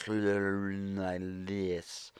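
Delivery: put-on very slow, slurred drunken drawl; mock drunken slurring; belligerent drunk. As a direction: imitate a sloppy drunk running words together. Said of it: "belligerent drunk".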